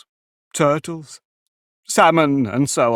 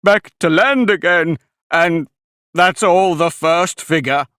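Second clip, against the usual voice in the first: high, louder, still carried away; excited; 7 semitones higher, 6 dB louder than usual. A narrator's reading. Mock Turtle finishes describing the first figure, high and loud. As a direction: keep the excitement up.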